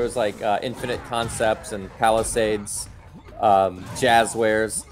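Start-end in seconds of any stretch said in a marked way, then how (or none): none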